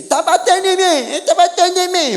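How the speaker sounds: put-on high, laughing whine of a kid; mock tattling son. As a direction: squeal it like a tattletale child. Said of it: "o filho chega assim".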